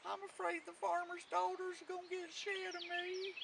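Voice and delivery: high, soft, wailing cat voice; old tomcat. A narrator's reading. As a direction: whine it softly like an old cat. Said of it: "It was an old tomcat".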